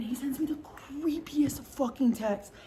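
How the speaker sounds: hushed and scandalised; whispered gossip about the creepy text; whispered aside. Real, not acted.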